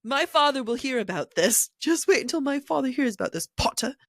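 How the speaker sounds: high, petulant spoiled-rich-boy impression; Draco Malfoy. Schoolboy threatening Potter with his father, petulant. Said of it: "Draco Malfoy".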